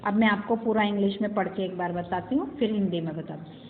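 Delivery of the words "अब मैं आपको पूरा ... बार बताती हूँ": ordinary talk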